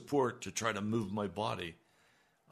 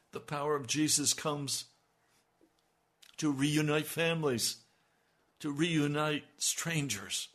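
rising preaching; 5 semitones higher, 5 dB louder than usual. Acted. Building preaching climax.

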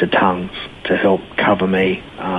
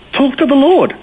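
very high-pitched, emphatic command; retold exhortation. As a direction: imitate a friend urging it, pitched way up. Friend's exhortation, retold on phone.

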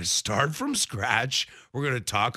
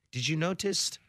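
usual speaking voice

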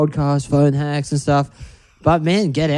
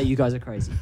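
quiet mock exasperation; 4 semitones lower, 9 dB quieter, faster than usual. Shown as a demonstration. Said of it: "I would say".

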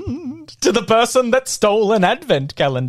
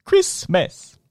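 normal talking voice

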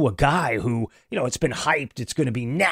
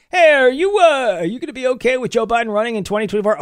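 mock reporter question; 10 semitones higher, 3 dB louder, faster than usual. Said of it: "people who were asked the question".

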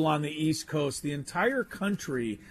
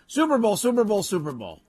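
defiant shout; 7 semitones higher, 4 dB louder than usual. Real, not acted. Defiantly repeating the banned name.

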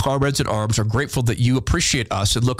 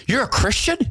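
surprised question; 9 semitones higher than usual. Retold or imitated. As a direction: ask it like you can't believe it. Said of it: "when she said to me".

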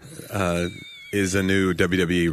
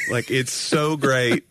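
ordinary talk, nothing marked

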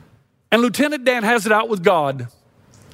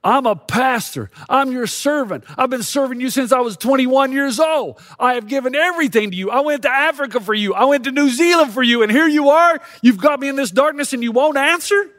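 venting at God; 4 semitones higher than usual. Retold or imitated. Pastor complaining to God from his couch, building anger. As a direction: pour out hurt to God, building. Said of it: "I let God have it".

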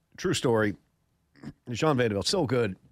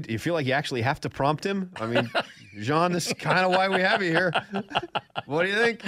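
prompting co-host; 7 semitones higher than usual. Retold or imitated. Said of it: "do you need ... prompt him".

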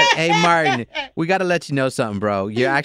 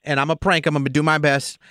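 normal talking voice

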